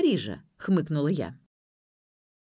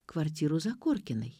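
usual speaking voice

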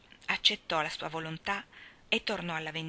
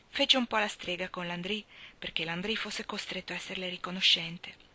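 usual speaking voice

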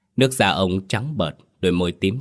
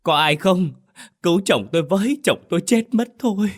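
crying call for help; 11 semitones higher than usual. A narrator's reading. Wife calling neighbours to save her husband, crying out. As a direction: wail across the yard for help. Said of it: "khóc lóc gọi với sang nhà hàng xóm".

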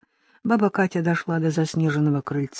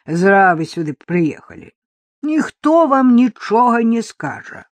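put-on distrustful old woman; 4 dB louder than usual.

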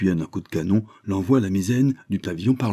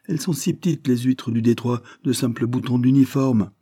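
much higher, sniffy voice; scoffing English second; 6 semitones higher than usual. Acted. Second officer scoffing at the captain's tale. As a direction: belittle the oysters.

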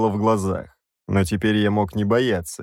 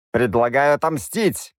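bloodthirsty suggestion; 4 semitones higher than usual. A narrator's reading. Parasite eagerly proposes revenge, bloodthirsty. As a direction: relish in revenge. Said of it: "кровожадно заявил Рабан".

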